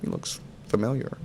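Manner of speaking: whisper. Retold or imitated. Voicing ladies at the supermarket.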